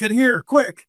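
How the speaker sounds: high, urgent hurry-up call; urgent soldier call. Acted. Soldier hurrying the players into the lift, high urgent call. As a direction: rush them into the lift.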